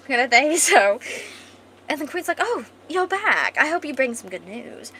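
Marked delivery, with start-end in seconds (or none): 2.4-4.9 posh queen. Acted. Posh queen greets returning agents, grandly. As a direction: swoop into a posh, regal greeting. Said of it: "This queen's a fucking posh".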